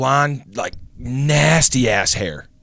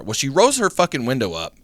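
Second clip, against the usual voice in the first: usual speaking voice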